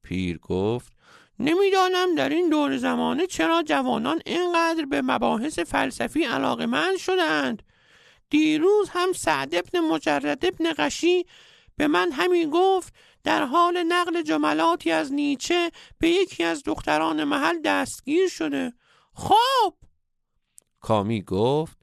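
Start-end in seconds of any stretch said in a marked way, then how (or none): 1.4-18.7 very high, piping wise-man voice; comic falsetto old master; squeaky old sage. A narrator's reading. 19.1-19.7 very high, louder burst; shrill comic interjection; squeaky sage exclaiming. A narrator's reading.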